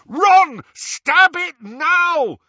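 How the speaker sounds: high, loud, drawn-out bellow. Acted. Harry urging Ron to stab the locket, bellowing. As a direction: roar it as an urgent command. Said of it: "Harry bellowed".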